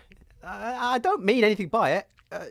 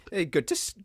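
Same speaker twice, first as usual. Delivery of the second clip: ordinary talk, nothing marked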